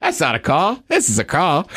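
put-on Crocodile Dundee line in a light Aussie accent; mock Australian. A hint of Aussie accent quoting the film. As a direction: riff on the knife quote in mild Australian. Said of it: "he's Australian, mate".

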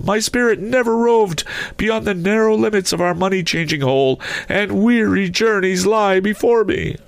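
very high, wailing phantom voice; mournful ghost. A narrator's reading. Ghost refusing comfort to his old partner, mournful high voice. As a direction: intone it high as a restless spirit.

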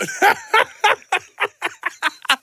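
high, loud staccato cackle; real cackle. Real, not acted. Host cracking up at the Mark Henry comparison, high cackle. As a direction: crack up in a long, high-pitched run of laughs.

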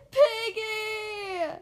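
high, drawn-out scream of the name; loud, anguished storybook cry. A narrator's reading. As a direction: scream after a departing friend. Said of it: "Gerald screams".

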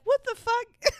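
shocked. Retold or imitated. Shocked reaction retold.

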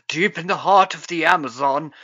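mock British narration; British narrator. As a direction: play up a British adventure voice-over. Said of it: "our British narrator".

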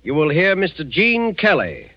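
ordinary delivery

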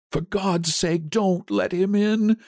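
put-on high, frightened old man pleading; trembling cry. A narrator's reading. Old man begging his wife at the door, trembling cry. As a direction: beg in terror, pitched high, dragging the last words. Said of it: "cried the old man, trembling".

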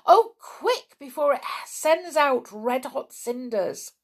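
high, loud, stammering in fear; frightened urging. A narrator's reading. Girl pointing out the volcano to Mister Luffy, terrified. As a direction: babble out the danger in a panic. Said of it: "Anne told him again".

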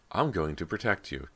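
ordinary delivery, nothing marked

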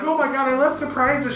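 giddy. Retold or imitated. Wife imitated at the card reveal, giddy and high. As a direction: gush like a kid getting a present. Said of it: "She's all giddy".

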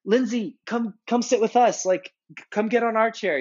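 high, eager call to get her over; imagined excited shout-out to a celebrity. Pretend. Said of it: "I'm like, "Why didn't I say something and be like".

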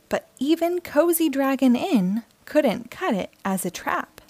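gentle storybook read-aloud; friendly picture-book narration for kids; reading to children. A narrator's reading. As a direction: read it like bedtime story time.

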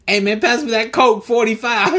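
high loud goofy party voice; hamming up a drunk teen calling for pop; mock drunk buddy.

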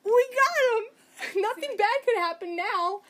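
high, slow, loud cheering; mock the careless heroes; triumphant gloating. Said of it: "waving the blades in the air going".

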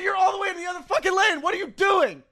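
high, fast angry shout; high fast furious shout. Retold or imitated. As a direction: yell across the lane. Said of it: "I said".

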